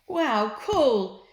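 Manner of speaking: loud, high excited shout; children shouting. A narrator's reading. Children shouting with joy over their loot, loud and high. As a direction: yell it out like thrilled kids. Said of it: "shouted the children".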